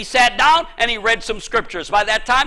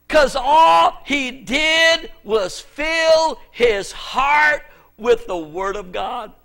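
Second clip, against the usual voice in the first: preaching; much slower than usual. Real, not acted. Preaching emphasis.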